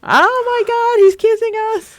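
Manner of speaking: very high, loud shriek of delight; real high-pitched fangirl squealing; excited squeal. Real, not acted. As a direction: squeal like a giddy fan. Reacting to the kiss scene.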